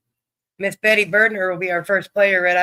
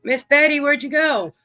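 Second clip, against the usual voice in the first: calling out; 6 semitones higher, 5 dB louder than usual. Real, not acted. Calling out to a missing player.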